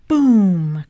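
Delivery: loud, drawn-out boom; big storybook sound effect; booming crash. A narrator's reading. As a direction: boom it out like a collapse.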